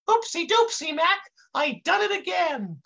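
very high, goofy intern voice; high kid voice. A narrator's reading. Kid shrugs off the spilled coffee, high voice. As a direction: squeak it out, way up high. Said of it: "the kid says in this high voice".

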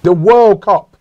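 high, loud, astonished emphasis; incredulous insistence. Real, not acted. Host reminding co-host what England could win, loudly incredulous. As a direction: hammer it home in disbelief. Arguing against co-host's choice.

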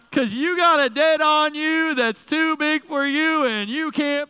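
preacher building to a roar; full-force preaching. Acted. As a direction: drive it home like a preacher at full tilt. Preaching to the congregation.

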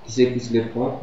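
usual speaking voice

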